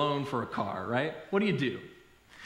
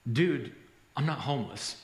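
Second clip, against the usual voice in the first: low, matter-of-fact correction after a pause; deadpan reply; 4 semitones lower than usual. Retold or imitated. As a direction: set him straight, low and unhurried. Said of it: "finally paused, and, and he said".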